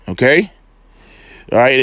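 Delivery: usual speaking voice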